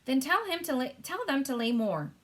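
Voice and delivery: high, commanding queen voice; the Queen, imperious. Acted. Queen ordering the butler about the hens, imperious. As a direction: snap out the royal order.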